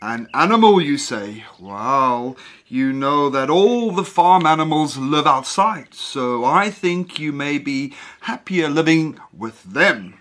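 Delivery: low, amused father voice; father humoring his son with a chuckle. Acted. Father amused, plays along.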